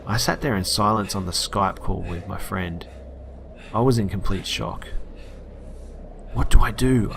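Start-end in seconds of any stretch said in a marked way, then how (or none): none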